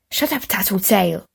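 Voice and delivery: plain reading voice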